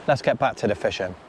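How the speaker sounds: ordinary delivery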